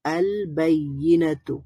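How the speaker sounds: "আল বাইয়িনাতু" drawn out slowly, l sounded; lam pronounced. Shown as a demonstration. Teacher repeats it for learners, lam pronounced. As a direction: stretch each syllable of "আল বাইয়িনাতু", keeping the l. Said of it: "তার মানে লাম উচ্চারণ হবে".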